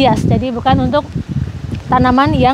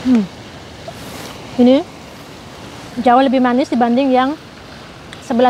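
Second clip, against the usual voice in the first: eating orange. Real, not acted. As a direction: mouth full while tasting. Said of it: "Saya coba ambil satu ya".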